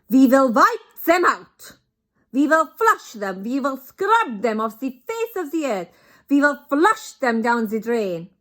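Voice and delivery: put-on loud, high accented witch rant, w said as v; Grand High Witch ranting. Grand High Witch rallies the witches, loud accented rant. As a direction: spit each threat at the crowd.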